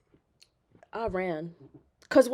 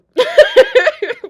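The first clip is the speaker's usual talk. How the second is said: high nervous laugh; 14 dB louder than usual. Real, not acted.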